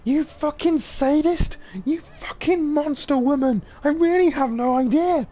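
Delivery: shrieking high and loud, slowed and unhinged; manic howling. Acted. Tortured man howling madly at the woman hurting him. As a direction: howl it out like a man in agony who has lost his mind. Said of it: "he starts howling manically".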